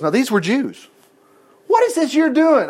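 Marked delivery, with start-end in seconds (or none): 1.7-2.7 higher-pitched, outraged question; indignant demand. A narrator's reading. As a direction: read it as a pointed demand. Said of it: "I demanded".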